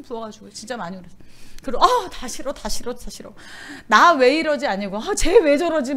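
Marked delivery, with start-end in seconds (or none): 1.8-4.7 mock crying. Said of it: "막 이러고 막 진짜 많이 울었어". 5.0-5.9 mock crying. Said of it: "막 이러고 막 진짜 많이 울었어".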